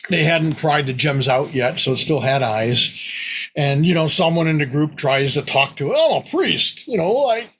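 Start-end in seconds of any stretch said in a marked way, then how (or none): 5.9-7.5 high player voice. Retold or imitated. Player voiced talking to god.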